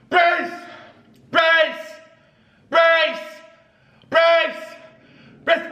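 high, falling shout of brace. Acted. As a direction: call the order out, falling. Shouting commands in game.

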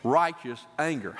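ordinary delivery

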